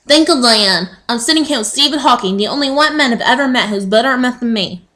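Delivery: Trisha Takanoa. Acted. Field reporter answering the news anchor, hammed-up impersonation. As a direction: rattle it off as a cartoon TV reporter. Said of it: "that is probably my best impersonation".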